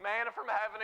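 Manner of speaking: ordinary delivery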